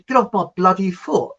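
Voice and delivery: imitated cross complaint; annoyed retort. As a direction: snap it like a trodden-on dance partner. Friend snapping, mistaken for a song title.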